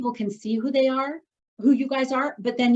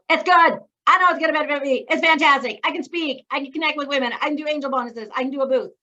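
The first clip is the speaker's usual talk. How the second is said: eager team member; 5 semitones higher, 4 dB louder than usual. Pretend. Voicing a team member's enthusiasm.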